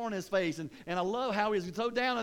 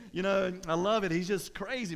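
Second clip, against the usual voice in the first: ordinary talk, nothing marked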